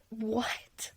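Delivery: breathy stunned whisper; whispered disbelief. Real, not acted. Reacting in disbelief to her question.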